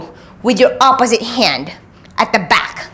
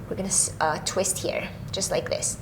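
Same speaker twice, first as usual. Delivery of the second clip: whispering; 4 semitones lower, 14 dB quieter than usual. Real, not acted. Quiet cue during twist.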